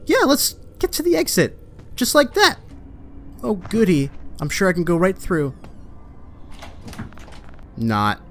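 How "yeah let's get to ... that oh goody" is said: mocking echo. Mocking the game character's line.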